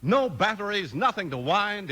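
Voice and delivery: usual speaking voice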